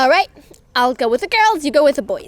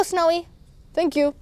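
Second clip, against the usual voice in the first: usual speaking voice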